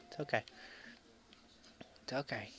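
very soft, near-whispered reassurance; hushed self-soothing. Real, not acted. Gamer consoling himself after dying, hushed self-soothing. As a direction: say it under your breath to settle yourself.

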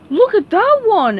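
high, loud excited exclaim; Patrick exclaiming. Acted. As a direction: shout it out with childlike glee. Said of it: "exclaimed Patrick".

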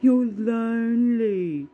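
slow, high mocking echo of his words; mocking quote. Mocking the man who calls her lonely, slow and high. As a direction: drag it out like you're parroting him. Quoting what he keeps saying.

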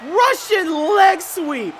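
loud, high, drawn-out call; excited shout. Real, not acted. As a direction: scream it at the big move. Big move in the match.

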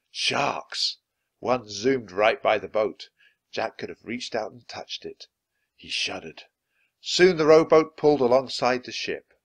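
usual speaking voice

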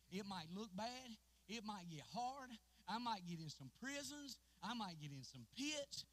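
a preacher starting to climb; preaching. Acted. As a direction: build the sermon slowly, rising. Preacher building up.

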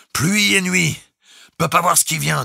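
high, loud, telegraphic report; distinctly called out. A narrator's reading. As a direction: call it over the storm, word by word. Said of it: "détachant distinctement tous les mots". (crié en détachant les mots)

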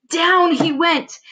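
slow, high, stretched-out words; dramatic drawn-out narration. A narrator's reading. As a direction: draw out the drop for suspense.

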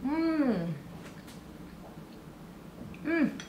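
humming while chewing; chewing noodles. Real, not acted. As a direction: mouth full of food. Seen 0: Slurps noodles at -1, closed mouth chewing throughout the Mms.

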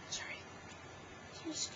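quick whispered sorry. Real, not acted. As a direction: whisper the apology. Unvoiced apology during clip.

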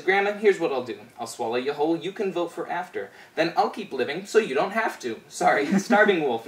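ordinary delivery

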